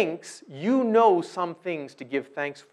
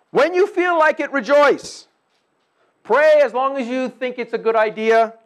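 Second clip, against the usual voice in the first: loud, high, mocking reading of the verse; mock loose paraphrase; 8 semitones higher, 8 dB louder than usual. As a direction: ham up the lazy version of the command.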